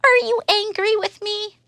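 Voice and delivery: very high small mouse voice; timid mouse. Acted. Mouse asking Elephant, high and tiny. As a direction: ask it in a tiny worried squeak. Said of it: "asks Mouse".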